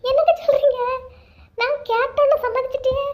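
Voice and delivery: high-pitched, astonished wife; delighted disbelief. Acted. Wife hears husband agree to the trip, high delighted surprise.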